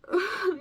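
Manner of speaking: a sharp high laugh-sob through tears; tearful broken laugh. Real, not acted. Daughter breaks into a laugh-sob remembering her mother. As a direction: laugh through crying, unable to hold it.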